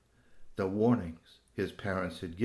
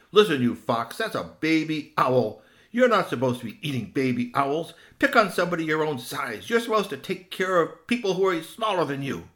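loud, higher scolding voice; stern Strongbones; 6 semitones higher, 7 dB louder than usual. Acted. Human scolds fox for eating baby owls, loud and firm. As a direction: tell the fox off firmly.

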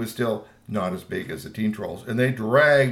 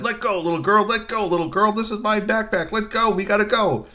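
raised, higher, insistent voice; impatient teen troll; 8 semitones higher, 4 dB louder than usual. A narrator's reading. Teen troll tugging backpack from a girl, impatient. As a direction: badger the little one to release it.

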